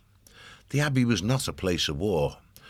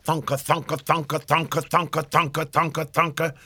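drumming sound; 10 semitones higher than usual. A narrator's reading. Pounding the hollow log alarm.

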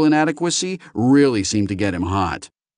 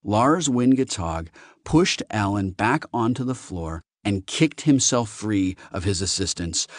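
ordinary delivery, nothing marked

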